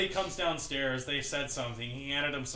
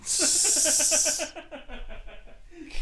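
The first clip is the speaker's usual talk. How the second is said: high, long burst of laughter; real laugh; 14 semitones higher than usual. Real, not acted. Teller finishing the puking story, real high laugh.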